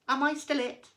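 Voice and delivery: a tiny fish asking innocently; little fish voice. A narrator's reading. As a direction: squeak it out bewildered. Little fish swallowed and spat out.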